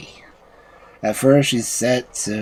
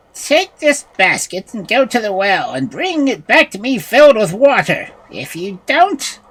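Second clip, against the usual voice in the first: commanding witch; 10 semitones higher, 4 dB louder than usual. A narrator's reading. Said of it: "she called Prunella to her and said".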